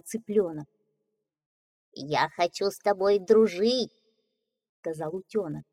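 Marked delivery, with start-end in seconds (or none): 1.9-3.9 cheerful little duckling. Acted. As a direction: voice the young duckling brightly. Duckling speaks.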